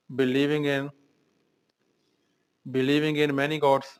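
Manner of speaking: believing in many gods said very slowly for students to copy; slow dictation. Shown as a demonstration. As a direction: dictate it word by word. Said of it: "teacher repeats phrase slowly".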